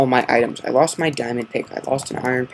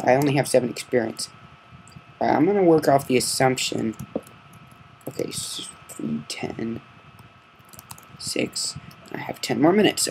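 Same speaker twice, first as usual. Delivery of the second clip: ordinary talk, nothing marked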